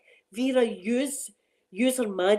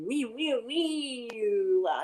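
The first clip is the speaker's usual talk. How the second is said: mock meowing; much slower than usual. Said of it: "she's got the Strimmer up there going".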